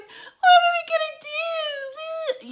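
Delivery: very high, drawn-out mock whine; exaggerated slow, squealing wail; whiny Danielle. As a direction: imitate an annoying whiner, loud and wailing. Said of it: "Danielle is so annoying".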